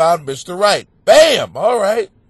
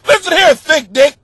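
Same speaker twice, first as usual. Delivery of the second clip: loud, higher-pitched telling-off; mock-angry complainer; 7 semitones higher, 6 dB louder than usual. Angry letter writer addressing the host, mock-indignant. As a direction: scold with hammed-up indignation.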